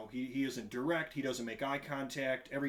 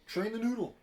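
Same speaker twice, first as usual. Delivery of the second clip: mock command; 8 semitones higher than usual. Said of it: "teasing his brother".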